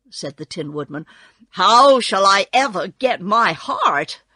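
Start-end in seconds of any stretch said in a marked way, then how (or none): 1.5-4.2 loud, troubled appeal; dismayed. A narrator's reading. Tin Woodman losing hope of a heart, dismayed. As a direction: lament it loudly.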